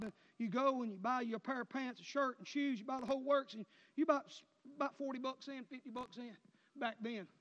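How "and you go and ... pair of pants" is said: ordinary talk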